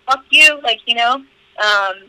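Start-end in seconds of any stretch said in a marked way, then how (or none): 0.1-0.6 sharp, louder and higher mock yell at the bully; retold angry outburst. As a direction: spit it out like a protective kid sister. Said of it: "and I'd be like".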